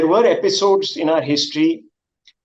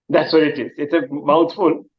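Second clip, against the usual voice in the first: normal talking voice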